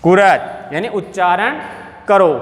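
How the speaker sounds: usual speaking voice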